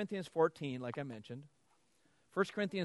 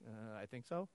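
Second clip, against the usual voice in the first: softer, sheepish mumble; mock unsure; 4 semitones lower, 10 dB quieter than usual. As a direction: play the caught-out man. Imitating unsure listeners.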